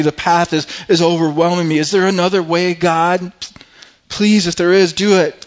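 rising cry of a man in anguish; crying out to God. Retold or imitated. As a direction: beg God, building to a loud anguished plea. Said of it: "he's just crying out".

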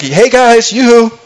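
cheeky, showing off his prize; teasing David. Retold or imitated. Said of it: "I kinda picture David coming".

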